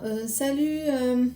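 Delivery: a lightly voiced embarrassed ex, all ums and pauses; hesitant awkward apology. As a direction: play him fumbling for a sorry he can't say. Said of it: "un petit peu comme ça".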